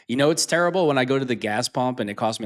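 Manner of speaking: normal talking voice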